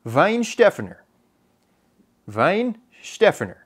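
Weinstephaner as VINE-SHTEF-en-er, German v and sh; earnest attempt at German Weinstephaner; careful German pronunciation. Shown as a demonstration. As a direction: say Weinstephaner deliberately, German-style, stressed on SHTEF. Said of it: "here's what I got for you".

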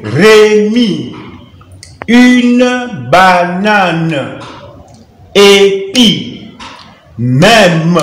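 very slow, drawn-out reading lesson delivery; syllable by syllable. Shown as a demonstration. Teacher training learners to read words, slowly syllabified. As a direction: read it out with each syllable stretched and separate.

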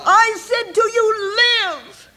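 high, crying voice; tearful. Real, not acted. Preacher moved to tears repeating God's word, crying. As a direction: let the tears break through the command.

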